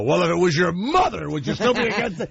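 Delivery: high, fast needling retort; mocking questioner voice. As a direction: sneer it like a smug debater. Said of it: "and then they go".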